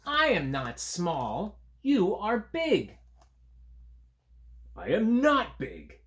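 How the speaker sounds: stubborn little creatures talking back; arguing creatures. Acted.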